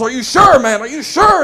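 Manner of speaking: loud, high urgent demand; frantic CEO. Acted. Panicked CEO grabs intern, loud and high. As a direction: shake him for an answer.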